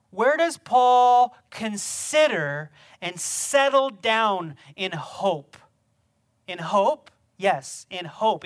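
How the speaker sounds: slow, loud, drawn-out preaching; emphatic slow question. Acted. Pastor pressing his congregation on hope, slow emphatic. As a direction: lean on every word and make them wait.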